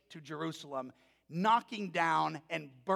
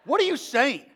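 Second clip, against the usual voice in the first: baffled dad; 6 semitones higher, 9 dB louder than usual. Retold or imitated. Dad reacting to daughter.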